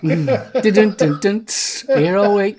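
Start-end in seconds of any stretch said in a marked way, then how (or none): 0.6-1.9 rimshot sung out loud; ba-dum-tss after a joke; drum sting. Pretend.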